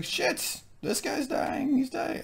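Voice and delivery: high, soft panicky voice; mock panic. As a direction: fret in a high voice.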